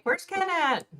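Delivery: high, sing-song call for a missing friend. Retold or imitated. Streamer retelling how she asked her chat where Ken went, high calling out. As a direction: call out high as if looking for someone. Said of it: "I was like".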